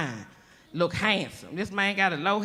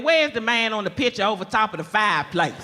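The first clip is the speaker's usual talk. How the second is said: mock shout. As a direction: call out for your old husband, laughing. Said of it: "ask him sometime".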